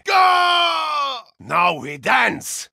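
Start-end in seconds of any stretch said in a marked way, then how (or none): 0.1-1.2 shouted command. Acted. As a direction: launch the charge. Battle cry.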